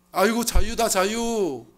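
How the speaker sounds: shouted high and loud, giddy release; mock joyful cry of freedom. Sinner cheering his escape from God, loud and giddy. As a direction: cry out like someone freed from God's rule. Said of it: "이렇게 하면".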